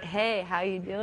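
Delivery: low, deep American accent on request. Shown as a demonstration. Repeating her phone greeting for the host, low American accent. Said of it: "do the American accent again".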